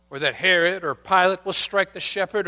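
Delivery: normal talking voice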